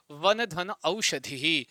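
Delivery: वन धन औषधि, each part said very slowly and apart; teacher dictating the split form, slowly; slow spelled-out split. Shown as a demonstration. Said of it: "वन धन औषधि क्या बन जाएगा?".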